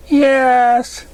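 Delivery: high, loud filly call from another room; calling out for mom. Acted. Filly calling her mother from the kitchen, loud and high. Dinky calls.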